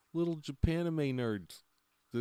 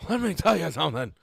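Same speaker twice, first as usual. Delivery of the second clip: louder and faster, punching "tell" hard; loud emphatic; 9 dB louder, faster than usual. Shown as a demonstration. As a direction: hit "let me tell you something" like a warning. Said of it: "But let me tell you something".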